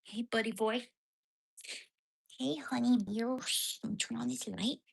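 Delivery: high, sing-song pet voice. Real, not acted. Woman cooing to her dog in baby talk.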